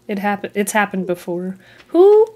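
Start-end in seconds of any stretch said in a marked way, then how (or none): 1.9-2.3 sudden high, loud who; mock outraged who. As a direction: pop up in playful outrage. Mock outrage over tent.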